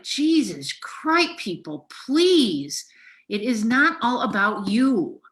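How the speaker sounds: high, drawn-out outraged appeal; enraged. Real, not acted. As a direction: plead with people, real anger in it. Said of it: "I was enraged".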